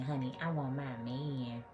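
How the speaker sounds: usual speaking voice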